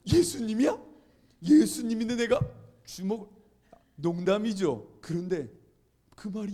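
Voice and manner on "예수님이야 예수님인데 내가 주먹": high, loud disbelief; incredulous outburst. Retold or imitated. Student reacting to his senior's remark, incredulous. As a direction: blurt it out, acted astonishment.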